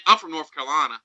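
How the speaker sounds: light mock Southern accent. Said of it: "I'm offended by your Southern accent".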